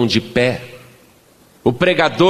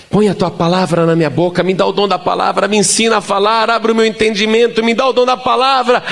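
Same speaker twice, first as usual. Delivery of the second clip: insistent plea climbing ever higher in pitch; pleading prayer; 8 semitones higher, faster than usual. Retold or imitated. Preacher re-enacting his prayer for the gift of speech, pleading. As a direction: re-enact begging God, high and quickening. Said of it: "E eu fiquei pedindo".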